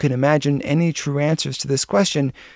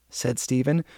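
plain reading voice

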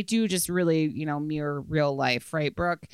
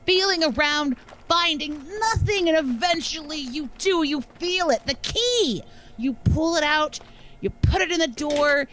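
building suspense; 8 semitones higher than usual. Acted. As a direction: pitch climbing higher and higher. Rising suspense narration.

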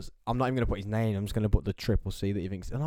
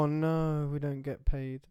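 higher-pitched pretend dismay; put-on woe over a small grievance; mock lament; 4 semitones higher, slower than usual. Joking with mates about no pay, mock lament.

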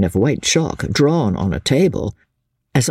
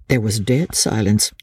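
usual speaking voice

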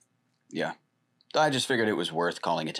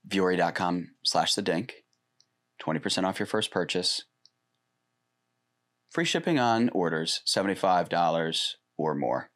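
ad read. Acted. Sponsor ad read.

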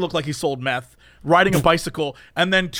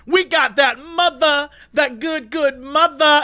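high, slow triumphant holler; hammed-up drunken boast, loud and high; imitated shouting; 8 semitones higher, 4 dB louder, slower than usual. Drunk men leaving CVS with alcohol, high mock shout. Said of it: "shout at all of us".

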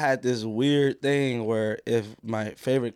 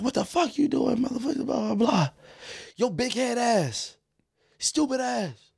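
higher, mock scolding mom; yelling mom; 8 semitones higher than usual. Mom hollering everyday insults at her son. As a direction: rattle off the insults like an angry mom. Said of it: "all the other insults".

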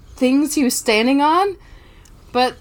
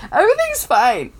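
mock reassurance; 6 semitones higher than usual. Real, not acted. Mock reassurance about the move, then laughs.

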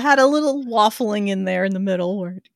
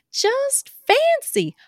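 giddy gush; 10 semitones higher than usual. Acted. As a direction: gush like a flattered belle. Giddy over three proposals.

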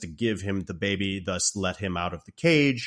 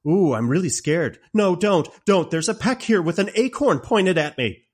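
high, loud taunting mock terror; a mocking prisoner pretending to be scared of an acorn; sarcastic mock fear; 8 semitones higher, 6 dB louder than usual. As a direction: jeer at the Nelwyn like his acorn is a joke. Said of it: "one of my favorite lines".

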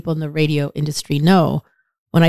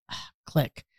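whispered giving up; 3 semitones lower, 11 dB quieter than usual. Retold or imitated. Giving up on phone call.

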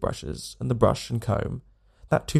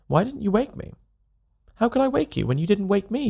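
retort; 8 semitones higher than usual. A narrator's reading. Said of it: "he retorted".

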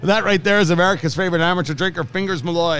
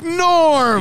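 high, drawn-out call of a name; mock crowd greeting; 10 semitones higher than usual. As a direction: call out like regulars greeting a friend.

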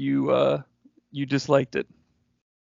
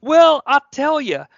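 higher, louder, slow Cornette drawl; Jim Cornette voice; 9 semitones higher, 7 dB louder, slower than usual. Acted. Co-host notices his Jim Cornette voice. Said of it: "Jim Cornette".

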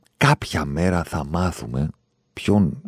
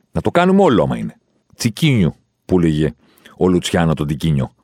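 ordinary talk, nothing marked

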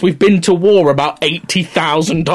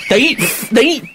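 mock outrage; 8 semitones higher, slower than usual. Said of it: "mock outrage at the French".

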